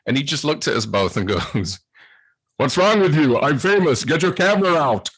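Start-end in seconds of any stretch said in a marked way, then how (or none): 2.6-5.1 high, loud Austrian-accented Arnold impression; a hammed-up Austrian Arnold, louder; Arnold Schwarzenegger. Arnold mocking starstruck fans, loud Austrian accent. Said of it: "sounds exactly like something Arnold Schwarzenegger would say".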